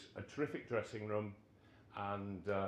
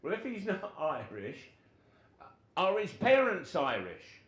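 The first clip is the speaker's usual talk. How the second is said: builds from a raised question to a loud demand; pitched up and getting louder; manager pressing; 8 semitones higher, 6 dB louder than usual. Retold or imitated. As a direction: push the question harder at the end. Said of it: "Gordon Lee says".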